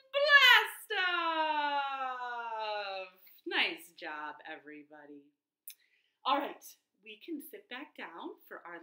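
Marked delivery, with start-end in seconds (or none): 0.1-3.1 high, loud blast-off shout; excited shout. Shown as a demonstration. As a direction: shout it like a launch. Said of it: "Let's try it again".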